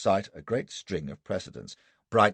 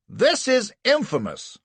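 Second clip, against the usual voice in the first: much higher, louder, slow roar; 7 dB louder than usual. Acted. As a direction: explode in outrage at the court. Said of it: "roars Anderson".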